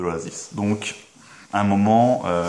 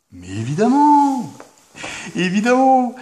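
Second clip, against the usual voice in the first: exaggerated exclamation; 6 dB louder than usual. Mock outrage about the orange.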